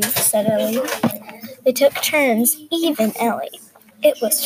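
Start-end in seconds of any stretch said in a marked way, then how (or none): none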